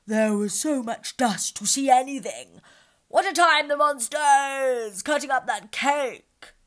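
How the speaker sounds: loud, slow lion complaint; loud impatient lion; impatient grumble. Acted. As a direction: grumble loudly.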